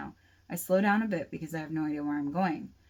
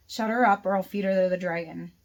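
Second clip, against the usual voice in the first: loud, threatening shout; loud menacing yell from a captor; Darius shouting; 7 dB louder than usual. A narrator's reading. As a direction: bark the threat right into her ear. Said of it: "Darius shouts in my ear".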